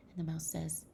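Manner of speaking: normal talking voice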